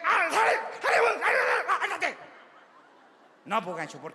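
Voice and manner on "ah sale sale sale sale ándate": put-on high, loud yelling to shoo the kid out; hammed-up frantic parent shout; panicked parent yell. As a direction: shout the child out of the bathroom. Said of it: "¿qué hace usted?".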